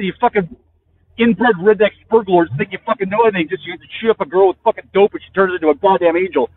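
normal talking voice

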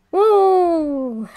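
loud, high startled whoa; surprised. Real, not acted. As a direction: yelp at a sudden scare. Sudden startle at the helm.

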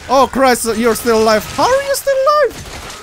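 high, loud panicked yelling; panicking. Real, not acted. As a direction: babble in real panic at the enemy. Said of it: "I'm panicking".

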